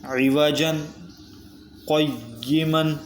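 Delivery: slow melodic chant with a pause after عِوَجًا; Quran recitation. Shown as a demonstration. Teacher recites the fourth example, slow melodic chant. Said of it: "اگلا حرف ہے".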